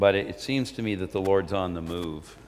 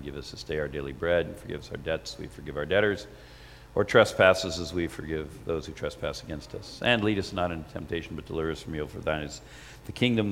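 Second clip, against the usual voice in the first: prayer recitation; 4 semitones lower than usual. Acted.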